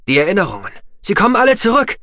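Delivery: high, loud triumphant shout; triumphant call. A narrator's reading. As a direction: cry it out in triumph. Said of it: "rief er ihr triumphierend zu".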